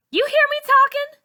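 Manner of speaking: very high, loud furious yell; angry shout. A narrator's reading. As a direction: scream it in her face. Char shouts at frozen Malika.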